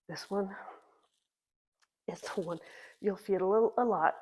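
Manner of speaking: talking through effort, short of breath; strained talking. Real, not acted. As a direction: push words out mid-exercise. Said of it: "I'm having a hard time talking".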